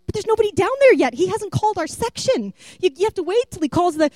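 whispering urgently to her dad that it's too early, hurried and high; anxious protest. Retold or imitated.

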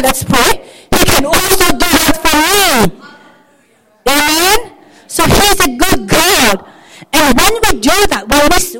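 loud, high, driving sermon delivery; full-force preaching. Real, not acted. Preacher urging believers that God can do it for them, fervently. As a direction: drive the point home to the congregation.

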